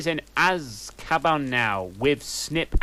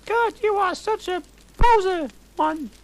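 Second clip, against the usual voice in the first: very high, louder scornful yell; high girly shout; 6 dB louder than usual. Acted. Ebony yelling at Hagrid in a high shrill girl voice. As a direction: shriek it at him like a diva. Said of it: "I shooted at Hagrid".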